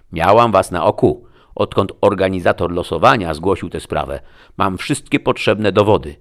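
ringing, loud official charge; official arrest announcement. A narrator's reading. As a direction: declare the arrest with forceful authority.

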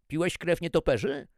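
loud, high-pitched demand; calling out. A narrator's reading. Chef calling to the Chinese trainee, loud high question. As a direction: fire the question across the kitchen. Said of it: "zawołał do chińskiego stażysty".

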